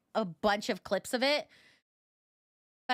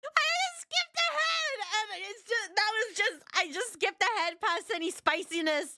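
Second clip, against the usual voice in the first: much higher voice, still overcome by laughter; breaking up laughing; 10 semitones higher than usual. Real, not acted. Streamer explaining she skipped past the spiciness, giggly and high. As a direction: squeak it out just after a laughing fit.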